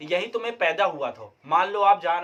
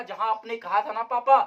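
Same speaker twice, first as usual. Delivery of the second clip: usual speaking voice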